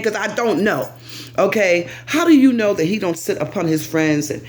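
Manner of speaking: normal talking voice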